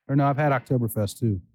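ordinary talk

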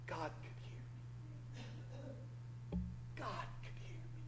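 very soft, awed-sounding whisper; hushed whisper. Acted. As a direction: drop to a near-silent whisper for effect. Hushed answer after the shouted cry.

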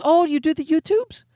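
high, put-on condescending lady voice; mock neighbor voice. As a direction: sound snooty and amused, like the neighbor.

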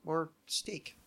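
ordinary delivery

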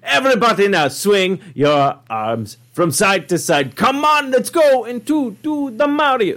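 high, hammed-up Italian Mario voice; Italian accent. Host leading an arm-swinging Mario bit, Italian accent. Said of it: "That's my only Italian accent".

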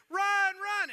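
high, loud yell; urgent shout of a cheering crowd. Acted. Crowd sending the batter to first, high loud yelling. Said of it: "they're, like, yelling at her".

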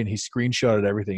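normal talking voice

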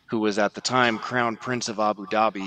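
ordinary delivery

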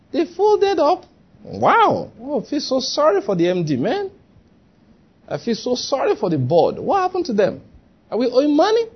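high-pitched mock dismay; shocked staff reaction. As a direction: act out stunned, sorry disbelief.

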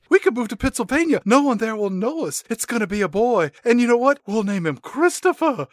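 high, fast, hammed-up unhinged mother; mock deranged mother. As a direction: gush it out like a crazed mom.

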